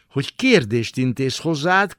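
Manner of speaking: ordinary delivery, nothing marked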